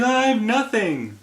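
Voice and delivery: high-pitched mock protest; hammed-up, high cry of complaint; mock wail. Acting out film character's protest.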